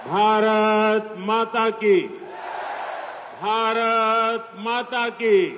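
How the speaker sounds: slow drawn-out chant to the crowd; roaring rallying speech. Real, not acted. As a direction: call out the slogan, hold every vowel.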